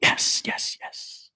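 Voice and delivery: ordinary talk, nothing marked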